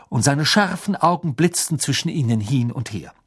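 ordinary delivery, nothing marked

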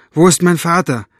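plain reading voice

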